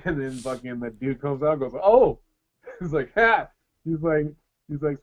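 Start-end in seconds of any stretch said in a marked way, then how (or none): 1.8-2.2 mock startled oh. Spirit startling the uncle. 3.1-3.5 mock ha. Spirit's loud laugh.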